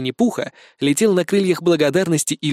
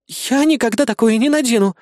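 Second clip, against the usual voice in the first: blurted out; 8 semitones higher than usual. A narrator's reading. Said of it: "тут же выпалила Кри".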